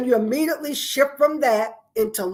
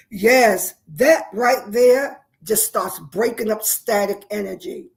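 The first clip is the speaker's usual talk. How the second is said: normal talking voice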